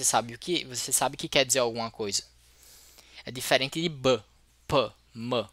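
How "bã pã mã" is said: b, p, m as short bare sounds "buh, puh, muh"; isolated speech sounds. Shown as a demonstration. Teacher showing sounds without meaning, isolated phonemes. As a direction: sound out b, p, m separately, not as letter names. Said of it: "que não querem dizer nada".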